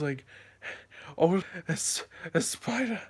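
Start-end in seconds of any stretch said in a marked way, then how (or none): 1.2-3.0 much higher, stumbling frightened report; scared squeak. Retold or imitated. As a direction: still shaken, forcing the words out. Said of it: "I couldn't even talk".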